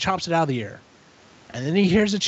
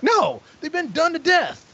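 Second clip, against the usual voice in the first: high-pitched retold tirade; berating; 9 semitones higher than usual. Tyler berates Todd over the overused spiders, high indignant rant. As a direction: scold him in a raised, indignant voice. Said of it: "he is berating him constantly".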